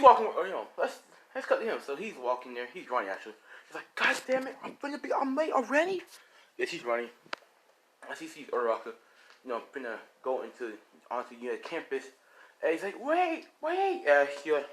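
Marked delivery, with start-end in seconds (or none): 3.9-6.0 high-pitched acted panic at running late; panicked exclamation. Late student cursing to himself while running to school, panicked. 13.0-14.0 high-pitched urgent call. Acted. Late student running up to the campus gate, calling out urgently. Runner calls out before gate closes.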